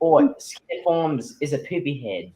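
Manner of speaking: put-on Australian accent. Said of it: "you got it there, mate".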